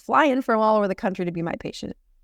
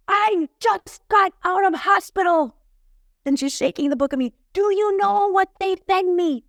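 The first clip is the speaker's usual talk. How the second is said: put-on Brazilian accent; 11 semitones higher, 3 dB louder than usual. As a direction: play the loud Brazilian lady outraged about hospital food. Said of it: "Brazilian woman with this really thick accent, and she's talking super fast and super loud".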